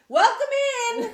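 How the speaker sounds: very high, loud shouted welcome; shop greeter yell. Retold or imitated. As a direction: belt it out like an overeager store greeter. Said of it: "somebody yelling at you".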